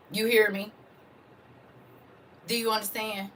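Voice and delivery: preacher warming up; rising preaching. Acted. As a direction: start climbing into the sermon.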